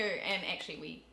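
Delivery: normal talking voice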